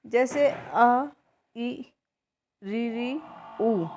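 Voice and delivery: slow, careful vowel demo, each vowel said alone; short vowels drawn out. Shown as a demonstration. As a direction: sound out आ, ई, री, उ slowly and separately. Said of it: "कम से कम समय लगता है, जैसे".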